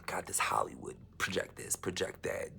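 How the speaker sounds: unvoiced conspiratorial whisper; a hushed whisper about Hollywood. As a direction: whisper it like a secret plot.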